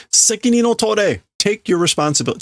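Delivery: high, fast demand for responsibility; angry demand. Acted. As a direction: hammer the demand home. Workers demand the leader's responsibility.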